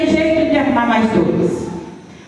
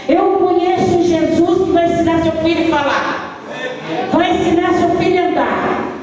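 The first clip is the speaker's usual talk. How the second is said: higher, fervent voice of a kind believer; comforting believer; 7 semitones higher than usual. Retold or imitated. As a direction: lift the voice and promise with conviction. The visiting believer comforts and preaches.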